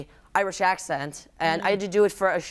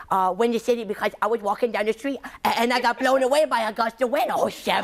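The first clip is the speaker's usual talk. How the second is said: higher, goofy Sandler voice with stammers; Adam Sandler impression; 5 semitones higher than usual. Guest on a talk show doing Adam Sandler, higher and rambling. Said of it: "Adam Sandler's kinda like".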